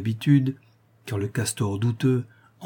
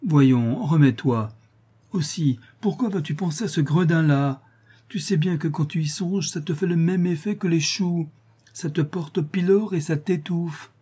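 put-on higher, fussing old woman voice; scolding old wife; 7 semitones higher than usual. A narrator's reading. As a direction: fuss over him like a nagging wife. Wife scolds husband tenderly. (vieille épouse grondeuse)

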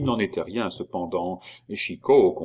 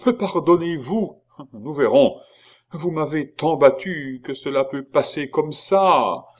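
anxious pleading; 6 semitones higher, 5 dB louder than usual. A narrator's reading. Monk pleads for pardon.

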